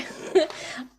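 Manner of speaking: breathy little laugh of delight; quick happy chuckle; airy delighted laugh. Real, not acted. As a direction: laugh out, thrilled. Delighted by leader's answer.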